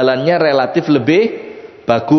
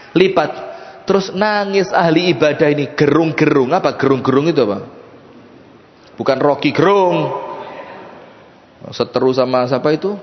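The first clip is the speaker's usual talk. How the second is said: ordinary talk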